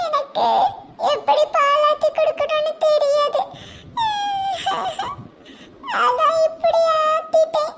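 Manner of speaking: high, slow crying plea with a nervous laugh; a scolded girl weeping and excusing herself; tearful pleading. Acted. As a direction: sob out an apology to grandma.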